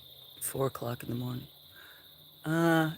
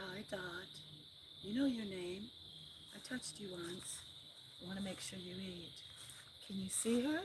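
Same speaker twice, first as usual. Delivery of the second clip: pet talk; 4 semitones higher, 11 dB quieter than usual. Real, not acted. Owner coos to her cats at feeding time, soft pet talk.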